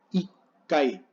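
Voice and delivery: ikai with a held stop before "kai"; doubled K in ikai as a silent gap; pause in ikai. Shown as a demonstration. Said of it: "con pausa".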